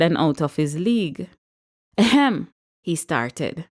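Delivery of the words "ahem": a nervous, higher ahem; throat clear. A narrator's reading.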